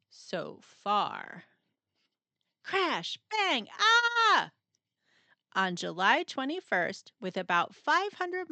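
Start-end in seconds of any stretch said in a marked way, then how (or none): 2.6-4.5 loud bang sound effects then a high yelp; crash and a cry. Acted.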